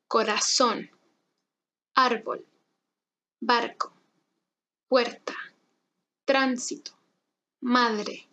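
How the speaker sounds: word list from "Oro" to "grande", each R tapped once; careful tapped R, no trill; soft R drill. Shown as a demonstration. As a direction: model each word carefully for repeating, with pauses and a single-tap R. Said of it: "Intenta conmigo".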